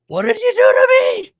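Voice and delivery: very high, loud shriek; hammed-up hysterical outburst; mock horrified scream. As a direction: shriek it in comic distress. Acts the villain's horrified outburst.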